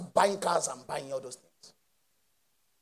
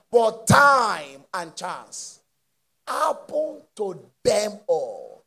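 slow, heavy stress on TIME and CHANCE; drawn-out emphasis; much slower than usual. Acted. Preacher drawing out the verse for the congregation. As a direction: stretch "But time and chance" out word by word.

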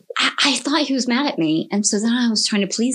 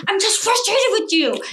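high-pitched acted exasperation; worked-up mock outburst; retold frustration; 11 semitones higher than usual. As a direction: act out being fed up with someone. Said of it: "I got mad and I was just like".

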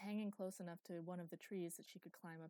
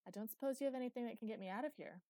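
quick, higher and louder teasing; 5 semitones higher, 6 dB louder, faster than usual. A narrator's reading. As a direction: tease her while asking for help. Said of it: "sounded like it was laughing at her".

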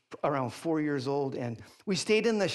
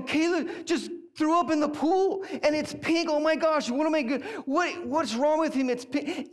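high, loud panicked babble; retold freak-out; 11 semitones higher, 6 dB louder than usual. Dad running to tell his wife about Caleb, hammed-up panic. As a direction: re-enact frantic alarm, pitched way up. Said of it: "and I'm, I freak out".

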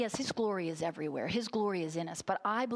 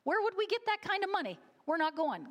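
much higher, fast, mock disbelief at the cost; incredulous protest; 10 semitones higher than usual. Wife retelling her refusal of the invitation, high incredulous protest. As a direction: squeal the objection as if money were impossible. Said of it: "That was my reaction".

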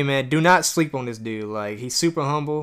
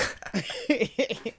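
high laugh; 9 semitones higher, 6 dB quieter than usual. Real, not acted. Laughing at the name.

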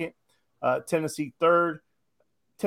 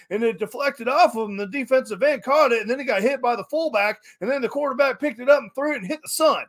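high, loud over-the-top bragging; mocking one-upper; 7 semitones higher, 4 dB louder than usual. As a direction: ham up a tall tale.

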